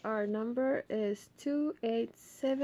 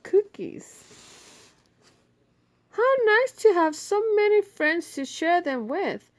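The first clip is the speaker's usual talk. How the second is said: Grandma's voice; 9 semitones higher, 9 dB louder than usual. Acted.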